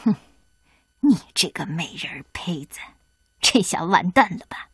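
low, hissing gloat; wicked hag whisper. Acted. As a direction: gloat quietly over the fallen girl. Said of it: "恶毒的老太婆说完". (恶毒的低声得意)

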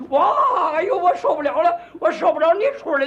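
comic bather cries out loud, mock pain; mock yelling. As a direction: play a bather wailing in the hot water. Bathers yelling in the hot pool.

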